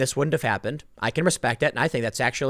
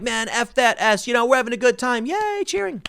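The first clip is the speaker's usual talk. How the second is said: mocking partier voice; 8 semitones higher than usual. Mocking the rock singer cheering to his crowd, high and silly. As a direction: ham up a careless partier who only wants a good time. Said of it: "he's like".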